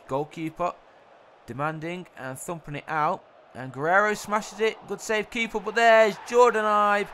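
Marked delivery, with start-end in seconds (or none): 3.5-7.1 loud, raised voice building to a shout; excited goal call. Real, not acted. Commentator calls Jordan Ibe's rebound goal, excited shout. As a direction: build to a shout on the scorer's name.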